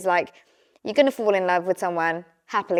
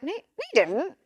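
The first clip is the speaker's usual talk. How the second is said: mock denial; 9 semitones higher, 4 dB quieter than usual. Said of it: "I was like".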